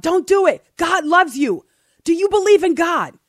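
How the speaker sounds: loud, high call to a man on a bridge; crying out. A narrator's reading. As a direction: shout it out to stop him jumping. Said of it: "cried out".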